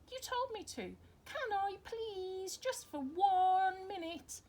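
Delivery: put-on high, slow, drawn-out begging of a small boy; a little boy coaxing his mum, high and slow; pleading child. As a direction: plead like a child who wants a turn. Lester begging to play his tune.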